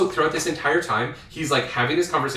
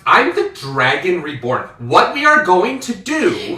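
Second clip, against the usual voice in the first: slow, loud and higher, imperious; commanding Rand; 6 semitones higher, 7 dB louder, slower than usual. Acted. Rand declares himself to the lords, loud and slow. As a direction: lay down the law slowly. Host voices Rand asserting power.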